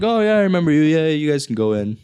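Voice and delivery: mock-friendly doorman waving them through; friendly recognition. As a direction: greet an old acquaintance at the door. Bouncer greets known guy at door.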